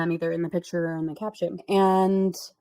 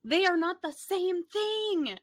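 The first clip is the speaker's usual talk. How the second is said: higher, slow, fed-up insistence; exasperated; 11 semitones higher, slower than usual. Real, not acted. To the MLM seller, slow exasperated correction. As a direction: spell it out slowly in disbelief.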